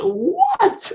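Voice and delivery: a high, sharp "What?" of disbelief; stunned what. Retold or imitated. Artist hearing the studio invite, stunned high what. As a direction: shoot the pitch way up in shock.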